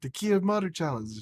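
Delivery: high, eager explanation; retold insistence. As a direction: explain it as if it were obvious.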